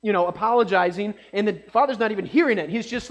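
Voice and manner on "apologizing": ordinary delivery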